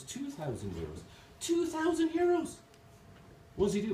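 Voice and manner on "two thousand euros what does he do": very high squeak of mock shock; incredulous customer. Irish customer balking at the second parrot's price, incredulous. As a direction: shoot the pitch right up in disbelief.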